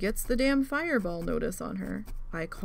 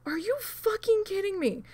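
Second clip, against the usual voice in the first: incredulous outburst; 9 semitones higher than usual. Retold or imitated. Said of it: "And I'm like".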